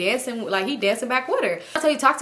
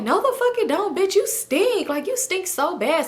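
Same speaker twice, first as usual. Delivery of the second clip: mock argument; 9 semitones higher than usual. Girl arguing back, high voice.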